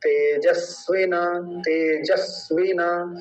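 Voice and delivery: तेजस्विना said slowly with a short वि; short i demo. Shown as a demonstration. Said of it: "बड़ा नहीं खींचेंगे". (छोटी इ, धीरे से)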